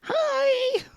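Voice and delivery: very high, drawn-out hi; awkward greeting. Retold or imitated. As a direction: squeak out an embarrassed greeting to passers-by. Awkward greeting to passers-by, retold.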